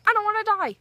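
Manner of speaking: high-pitched retold wail of fear; mock frightened plea. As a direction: plead for your life, hammed up and high. Retelling her panic on phone.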